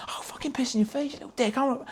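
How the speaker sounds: high-pitched mock threat; retold drunken rage. Threatening the freaked-out roommate on the bed, retold rage. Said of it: "seen red".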